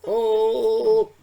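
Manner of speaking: sudden loud high shout; loud cry. Pretend. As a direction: belt it out. Thundercats cry.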